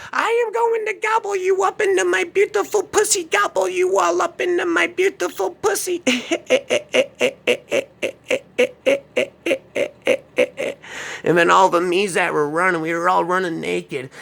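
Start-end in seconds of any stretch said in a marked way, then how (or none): 0.1-6.0 very high, cackling witch voice; crazy witch. Retold or imitated. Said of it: "heard her laughing that crazy laugh". 6.1-11.2 high, manic cackle; crazy witch laugh. Retold or imitated. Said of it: "that crazy laugh".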